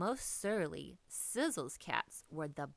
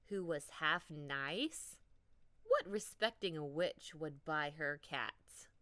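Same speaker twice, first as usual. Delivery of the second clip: usual speaking voice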